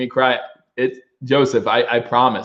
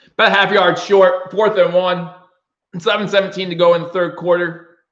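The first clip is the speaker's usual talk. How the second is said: play-by-play call; 4 semitones higher than usual. Acted. Fan streamer calls the game.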